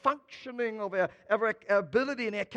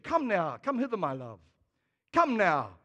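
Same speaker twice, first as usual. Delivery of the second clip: mock-genteel English accent, tender; posh English accent; 3 semitones lower than usual. Shown as a demonstration. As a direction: play a courtly English gentleman wooing his love. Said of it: "sounds very English".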